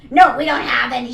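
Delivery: very loud retold refusal; imitated scream. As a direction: shout it as the rude cashier. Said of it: "that's how loud she fucking screamed".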